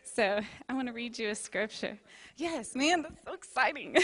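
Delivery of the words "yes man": normal talking voice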